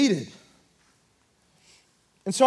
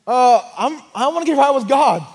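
raised, higher and louder voice; re-enacted calling out; 5 semitones higher, 6 dB louder than usual. Young man interrupting a church service to get right, calling out. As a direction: call out haltingly for attention, as if across a room.

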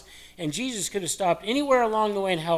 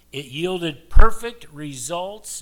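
booming slow proclamation; 12 dB louder, much slower than usual. Acted. Preacher hammers the point.

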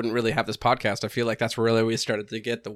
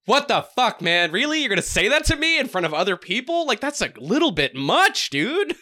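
high, loud offended protest; indignant outburst; 11 semitones higher, 4 dB louder than usual. Retold or imitated. Son protesting dad's insult in public, high and loud. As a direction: squawk your outrage at dad. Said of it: "I'm just like".

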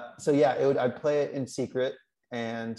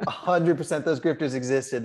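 normal talking voice

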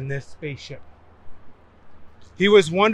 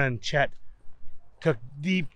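plain reading voice

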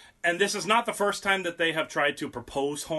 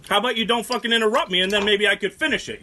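loud, high, fed-up outburst; real irritation, voice raised; angry retort; 6 semitones higher, 5 dB louder, faster than usual. Real, not acted. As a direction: snap back at the interrupter. Snaps at co-host for interrupting him.

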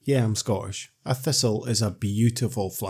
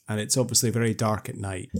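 ordinary talk

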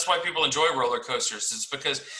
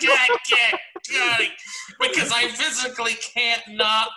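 very high, squeaky protest; helpless giggly protest; 7 semitones higher than usual. Retold or imitated. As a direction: re-enact struggling helplessly against laughter, high-pitched. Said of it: "I just kept laughing 'cause I was tripping so hard".

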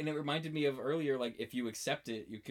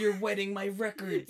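mock complaint; 7 semitones higher, 5 dB louder than usual. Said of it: "acted quarrel".

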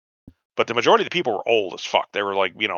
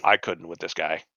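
low, much softer exasperated mutter; lowered, hushed exasperation; muttered aside; 7 semitones lower, 7 dB quieter than usual. Retold or imitated. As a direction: grumble it under your breath, fed up. Inner groan at the creep.

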